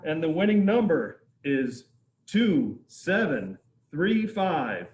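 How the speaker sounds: loud, slow announcement of the number; raffle caller. Real, not acted. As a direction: call out the winning number. Reading out the raffle winner.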